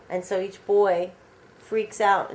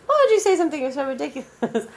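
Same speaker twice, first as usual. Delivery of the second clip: indignant retort; 7 semitones higher, 5 dB louder, faster than usual. Retold or imitated. Acted quote, indignant retort.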